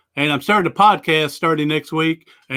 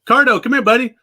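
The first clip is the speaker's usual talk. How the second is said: high, louder call to the dog across the room; 8 semitones higher, 4 dB louder, faster than usual. Real, not acted. Owner calling his service dog over, high and loud. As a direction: call him in, bright and carrying.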